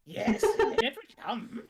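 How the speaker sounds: high, loud, slow croaking servant; Igor-Yoda voice. Acted. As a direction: fawn like Igor speaking as Yoda. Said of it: "Somewhere between Igor and Yoda".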